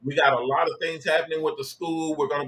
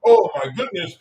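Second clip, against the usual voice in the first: mock weepy God; 8 semitones higher, 3 dB louder than usual. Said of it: "God doesn't sit there and".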